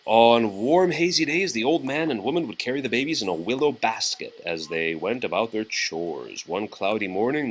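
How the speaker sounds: usual speaking voice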